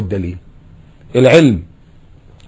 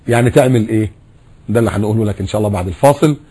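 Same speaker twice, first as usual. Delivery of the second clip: usual speaking voice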